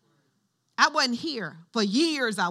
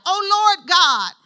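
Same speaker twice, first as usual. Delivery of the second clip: loud, high, slow scripture cry; Jeremiah's exclamation, preached at full force; crying out to God; 9 semitones higher, 12 dB louder, much slower than usual. Acted. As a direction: call out to heaven, drawing it out.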